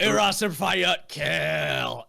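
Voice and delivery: put-on loud, slow, high drunken oorah; slurred mock battle cry. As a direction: bellow it like a wasted marine. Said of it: "I went".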